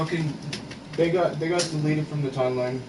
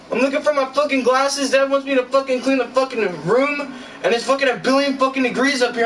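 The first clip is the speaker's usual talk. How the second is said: louder and higher, venting nonstop; frustrated rant; 7 semitones higher, 4 dB louder than usual. Real, not acted. Overheated in his room, he rants loudly, fed up. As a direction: yell it out, at the end of your rope. Overwhelmed, ranting at everything.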